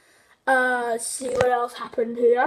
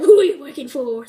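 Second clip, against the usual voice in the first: mock interrogation; 7 dB louder than usual. Patrick interrogating SpongeBob.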